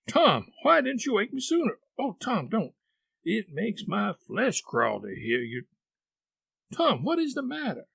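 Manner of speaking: high, urgent, pleading questions; frightened boy. Acted. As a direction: beg him to say what's wrong. Sid frightened for his brother.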